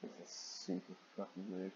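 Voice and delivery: low, soft muttered aside. Real, not acted. Reader muttering about the fanfic, soft and disbelieving. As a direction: mutter it under your breath, disbelieving.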